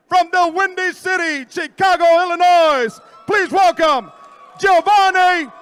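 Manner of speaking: high, loud announcer shout; ring announcer shout. Acted. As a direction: shout the champion's name to the arena. Main fighter introduction.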